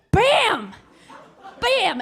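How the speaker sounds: high, punchy exclamation; imitated bang. Storyteller imitating a door bashed in, high punchy bangs. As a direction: punch each bang out high, with a gap between.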